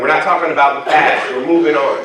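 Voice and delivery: ordinary delivery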